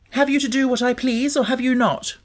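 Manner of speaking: put-on high, imperious boy demanding an answer; a sickly boy demanding obedience from his maid. A narrator's reading. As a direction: press her like a spoiled young master. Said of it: "he demanded".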